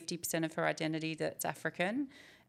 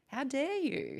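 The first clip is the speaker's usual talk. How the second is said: indignant quote; 9 semitones higher, slower than usual. Retold or imitated. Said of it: "she said".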